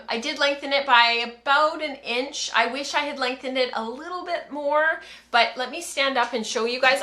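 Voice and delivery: ordinary talk, nothing marked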